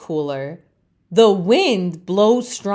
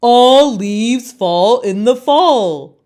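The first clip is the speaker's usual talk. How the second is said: slow, louder chorus of leaves; know-it-all leaves; 3 semitones higher, 7 dB louder, slower than usual. Acted. Other leaves scold Leaf, slow and loud. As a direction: lecture it slowly like smug elders. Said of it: "the other leaves say".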